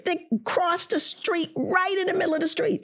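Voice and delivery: high, indignant exclamation; indignant outburst. Retold or imitated. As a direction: pitch it up in disbelief. Indignant son pointing out jaywalkers.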